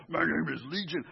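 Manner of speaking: brief put-on demonic growl; growly voice. Shown as a demonstration. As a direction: growly voice of an unclean spirit. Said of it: "I picture a growly voice".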